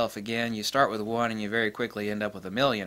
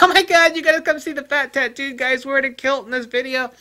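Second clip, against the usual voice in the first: mock excited viewer; 13 semitones higher, 8 dB louder than usual. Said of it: "They'd be like".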